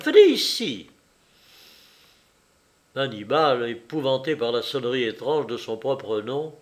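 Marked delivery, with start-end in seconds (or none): 0.1-0.9 high, loud summons; calling out. A narrator's reading. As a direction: call the animal over with a raised, high voice. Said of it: "s'écria Jeanne". (appel impérieux, voix haute et forte)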